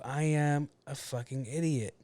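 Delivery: normal talking voice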